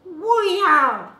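very high, loud cartoon whoop; Mario. Actor answering child's question, high cartoon whoop. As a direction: cheer in a high falsetto burst. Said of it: "which he picked up from Mario".

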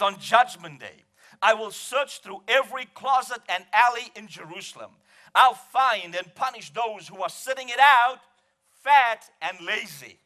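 ringing, emphatic pulpit reading; full-force scripture reading. Real, not acted. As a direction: proclaim it like a coming judgment.

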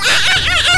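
short high repeated yelps; panicked screams. Pretend. Player swarmed by doll monsters, panicked yelps. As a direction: yelp over and over in alarm.

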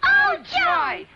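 high, loud happy exclaim; joyful cry. Acted. Children spotting their father, high joyful cry. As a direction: burst out with delight.

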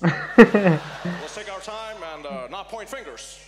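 slow, stalling presidential impression; Barack Obama. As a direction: drag it out like a cautious president. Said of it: "Obama was like".

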